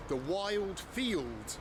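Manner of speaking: Wild Field drawn out slowly, weighty; slow dramatic emphasis. A narrator's reading. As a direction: savour each word, slow and grand. Dramatic naming of the region.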